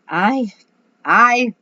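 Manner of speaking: rising loud nervous stutter; stuck for words; hesitant stammer. A narrator's reading. Howie stuck.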